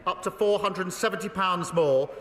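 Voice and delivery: high, forceful voice over the jeering chamber. Real, not acted. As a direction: project it hard to carry over the House.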